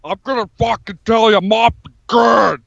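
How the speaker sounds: strained, deteriorating tough-guy impression; impression falling apart as it goes; Jesse Ventura. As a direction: shout it in a badly overdone wrestler voice. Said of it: "it just keeps getting worse".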